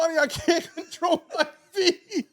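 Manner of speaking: high plea breaking into laughter; laughing mock panic. Telling sister he can't control his feet, laughing mock panic. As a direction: wail it while cracking up. Quotes himself while cracking up.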